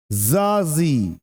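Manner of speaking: जर्सी said slowly as zaazee with no r; जर्सी spelled out slowly in sound; slow careful model. Shown as a demonstration. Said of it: "ये जर्सी नहीं है".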